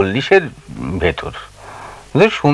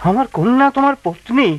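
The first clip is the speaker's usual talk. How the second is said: raised, pained protest to the groom; father shocked that the groom would abandon his daughter; indignant father; 6 semitones higher than usual. Acted. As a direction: rise up hurt and outraged.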